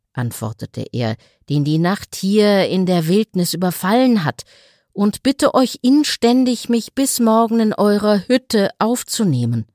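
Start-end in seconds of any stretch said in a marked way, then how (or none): 1.5-9.7 raised, earnest begging tailor; pleading tailor. A narrator's reading. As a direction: beg humbly for a night's shelter. Tailor begs for shelter.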